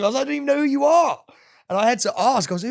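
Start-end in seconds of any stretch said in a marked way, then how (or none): 0.2-1.2 very high, loud retold protest; indignant protest. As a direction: protest at a bully, voice pitched right up.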